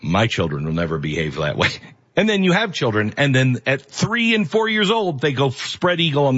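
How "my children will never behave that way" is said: mock smug prospective parent. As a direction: say it like a know-it-all before kids. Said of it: "you're like".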